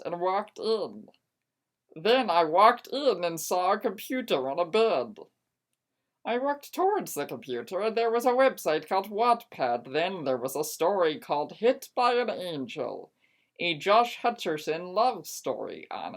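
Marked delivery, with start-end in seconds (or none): none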